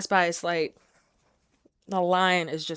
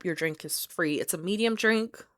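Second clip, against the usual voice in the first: ordinary talk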